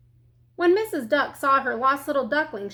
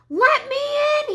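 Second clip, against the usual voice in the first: howling; 14 semitones higher, 6 dB louder than usual. A narrator's reading. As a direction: howl to be let in. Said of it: "he howled".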